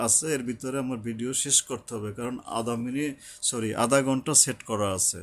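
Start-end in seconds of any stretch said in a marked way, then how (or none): none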